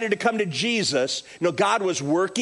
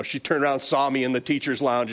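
ordinary talk, nothing marked

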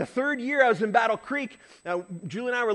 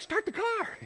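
high mock wife voice; 14 semitones higher, 5 dB quieter, slower than usual. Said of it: "Julie's like".

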